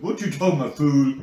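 loud rising catchphrase shout; Mr T style catchphrase. Retold or imitated. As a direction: bark the catchphrase with attitude. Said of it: "What you talking about, Will?".

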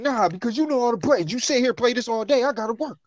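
high, loud complaining uncle; annoyed uncle. Retold or imitated. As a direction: whine at the kid to turn off the game. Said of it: "he was like".

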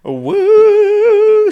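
long, high, loud "woo"; loud whoop. Real, not acted. Kicking off the next podcast part, whooping.

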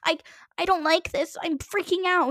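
high-pitched, hammed-up distress; mock tearful panic. Podcaster mimicking commenters upset by Quinn, mock panic. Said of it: "but they were like".

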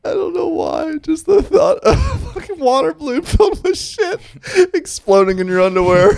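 high squealing disgust; disgusted shriek. Real, not acted. Disgusted at poop image.

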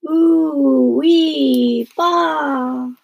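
high, loud drawn-out siren wail; imitated fire siren. A narrator's reading. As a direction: wail out each syllable like a siren. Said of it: "a noise like a fire siren".

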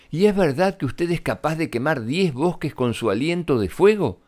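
lightly voiced clever princess, flattering and rising. Elizabeth asks again. (princesa astuta que adula al dragón)

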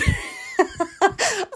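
real high-pitched giggle. Real, not acted. Laughing at the memory of her wife's bad mood, high giggle. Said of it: "she's never in bad moods".

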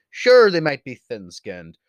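usual speaking voice

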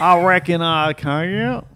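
loud, slow, hammed-up Southern drawl; exaggerated Southern accent. Shown as a demonstration. Man showing off for his co-host, broad Southern accent. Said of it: "can you do a Southern accent?".

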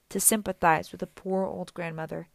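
plain reading voice

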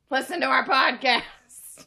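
called out loud as an ad; loud promo call; loud plug. Real, not acted. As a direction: shout the plug to the listeners. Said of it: "the internet will hear me".

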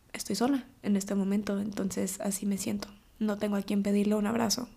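soft lonely whisper. Real, not acted. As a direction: barely voice it, alone. Admitting loneliness. (susurro triste y solitario)

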